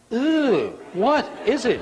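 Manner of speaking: high, loud disgusted groan at something new. Shown as a demonstration. Preacher mocking congregation, disgusted "ew what is it". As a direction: wrinkle your nose at it. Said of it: "What do we say? We say".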